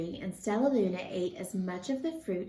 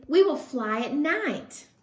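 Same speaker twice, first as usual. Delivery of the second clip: excitedly; 6 semitones higher, 5 dB louder than usual. A narrator's reading. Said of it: "Stellaluna said excitedly".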